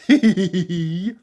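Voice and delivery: high loud hee-hee giggles; giggling. Acted. Mister Forgetful giggling along with the farmer, high giggles. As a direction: giggle like a naughty child. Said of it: "Mister Forgetful giggled".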